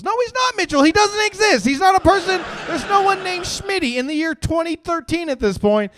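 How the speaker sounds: high, loud incredulous shouting; exasperated rant. Acted. Comedian calling out Mitchell's made-up war buddy, outraged shouting. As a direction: start at a shrieked protest, ease down gradually. Comic outraged outburst at friend's lie.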